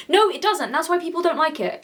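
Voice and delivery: ordinary talk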